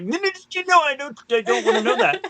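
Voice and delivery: no no no, squealed high; high panicked refusal. Retold or imitated. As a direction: panic at a spoiler. Refusing to hear the score.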